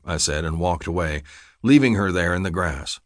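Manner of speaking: ordinary delivery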